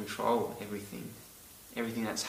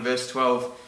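normal talking voice

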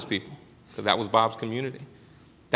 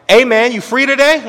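loud call; 12 semitones higher, 14 dB louder than usual. Retold or imitated. Bob calling on phone.

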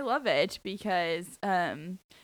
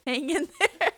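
playful squeaky repeat; high mock echo; 9 semitones higher, 6 dB louder than usual.

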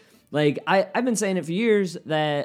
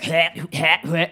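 loud, sharp mock retch; imitated dog retching; 5 dB louder, slower than usual. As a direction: mimic a dog heaving. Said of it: "My dog was just going like".

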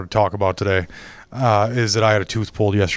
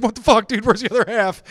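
retold shock, much higher in pitch; shocked outburst; 10 semitones higher than usual. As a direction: squeak out disbelief at the dentist. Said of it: "And I was like".

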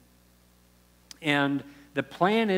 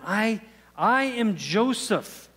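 slow, higher, choked-up reveal; overcome with emotion; 6 semitones higher, 3 dB louder, much slower than usual. Retold or imitated. Joseph reveals himself to his brothers, slow and overcome. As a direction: Joseph unmasking himself, voice breaking a little.

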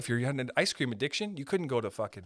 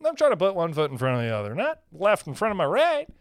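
mock earnest walker; 3 semitones higher, 4 dB louder, faster than usual. Mocking own careful walking.

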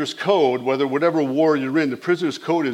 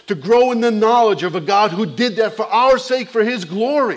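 full-force preaching; 6 semitones higher than usual. Acted. Pastor preaching hard to the congregation about eternity.

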